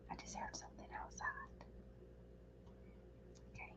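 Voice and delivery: quick, hushed whisper; frightened whisper. Real, not acted. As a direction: whisper it fast, afraid someone hears. Hears a noise while alone.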